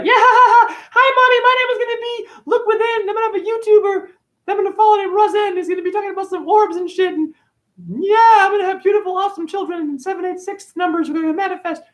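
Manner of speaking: put-on giggly baby in the womb, very high, chattering sweetly; mocking baby voice. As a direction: mimic a naive baby cooing to mommy. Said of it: "You don't talk like that in the, in the womb".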